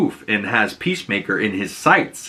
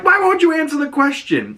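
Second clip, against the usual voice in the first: very high pleading question; desperate plea. Retold or imitated. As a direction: beg for an answer. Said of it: "And Chris is like".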